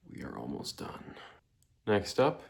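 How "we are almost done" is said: whispered and low; breathless whisper at 3 a.m. Real, not acted. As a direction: whisper it, worn out. Exhausted at three in the morning.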